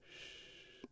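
very soft, drawn-out shh; barely audible shushing; faint shush. Acted. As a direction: hush the whole crowd under your breath. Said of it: "everybody goes".

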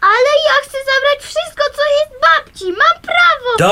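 tearful insistence, pitched high; insistent plea. Acted. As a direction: fight for grandma's things. Girl demands grandma's things.

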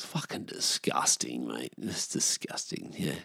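slow, quiet revulsion; disgusted mutter. Acted. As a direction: drop low and sneer slowly. Mock revulsion at such blokes.

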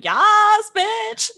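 a loud, high "Yes, bitch"; emphatic yes. Retold or imitated. Mom fondly recalls Rome latte, loud emphatic yes. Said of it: "she'll just be like".

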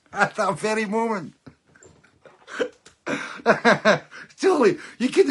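real laughter breaking through, high and loud; high, loud, delighted laughing speech; cracking up. Real, not acted. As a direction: laugh helplessly through the words. Cracks up at his own story.

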